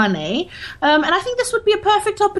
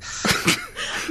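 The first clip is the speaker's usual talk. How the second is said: stifled laugh; 13 semitones higher, 8 dB quieter than usual. Real, not acted.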